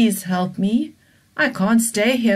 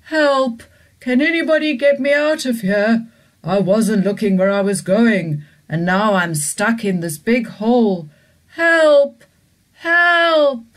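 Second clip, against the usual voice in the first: higher, louder pleading calls for help; stuck T-Rex calling; 5 semitones higher, 7 dB louder than usual. Acted. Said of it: "he said".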